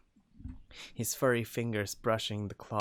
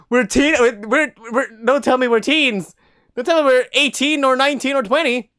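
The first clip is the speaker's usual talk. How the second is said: very high, loud squeaky protest; 17 dB louder than usual. Acted.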